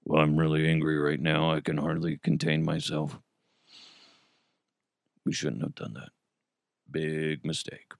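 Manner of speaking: very low, quiet deadpan monotone; Bill Belichick. Acted. Coach answering in the locker room after losing, barely audible deadpan. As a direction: claim fury in a low, subdued, lifeless voice. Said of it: "Let's actually go into the locker room. Bill".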